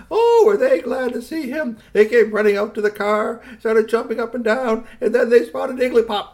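high, loud rush of joy; excited storytelling. A narrator's reading. Excited telling of children's joy.

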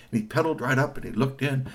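ordinary delivery

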